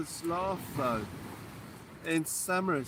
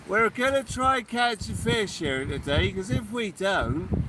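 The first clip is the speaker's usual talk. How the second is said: mock Somerset accent. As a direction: play a jolly Somerset yokel. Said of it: "Is that what they sound like in Somerset".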